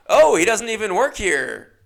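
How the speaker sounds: loud oh, then flat interesting; sincere surprise fading; host taken aback. Retold or imitated. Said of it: "And then she just goes".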